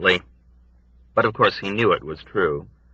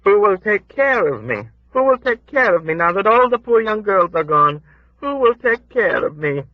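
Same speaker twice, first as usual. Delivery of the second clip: put-on high, pleading old woman's lament; 12 semitones higher, 3 dB louder than usual. A narrator's reading. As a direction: plead who will care for me, pitched up.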